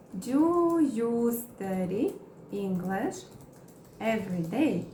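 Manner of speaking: very slow, broken into chunks; slow chunked teaching read; slow model question. Shown as a demonstration. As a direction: spell it out slowly for learners. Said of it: "Vamos criar mais exemplos".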